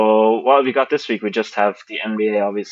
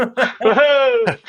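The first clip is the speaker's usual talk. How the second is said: excited whoop; 14 semitones higher, 3 dB louder than usual. Real, not acted. Cheering the announcer sign-off.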